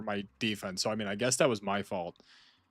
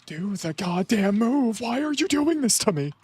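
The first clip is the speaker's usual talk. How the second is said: frustrated wail; 12 semitones higher, 6 dB louder than usual. Real, not acted. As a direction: plead with the game in anguish. Losing the fight, pleading with game.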